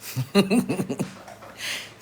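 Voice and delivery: airy laugh without voice; high airless laugh; breathy laugh. Real, not acted. Bursts out laughing mid-story.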